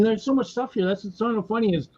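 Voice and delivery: ordinary talk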